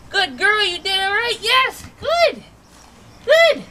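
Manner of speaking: praising the dog with big excitement; excited praise. Real, not acted. Trainer rewarding her dog with loud, high praise.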